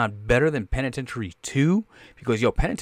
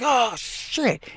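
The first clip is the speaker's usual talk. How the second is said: high startled "oh shit"; mock surprise; 12 semitones higher than usual. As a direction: caught off guard, pitched way up. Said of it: "I'm like".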